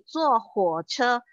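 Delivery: "Zuo huo che" said slowly, each syllable clear; slow Mandarin demo. Shown as a demonstration. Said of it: "contoh yang lain lagi". ("Zuo huo che" diucapkan pelan dengan nada Mandarin)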